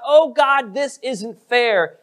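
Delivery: high mock cry of protest to God; imitated aggrieved outcry, slowing at the end. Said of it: "He never cries out".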